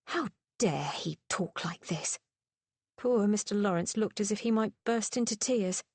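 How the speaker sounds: ordinary delivery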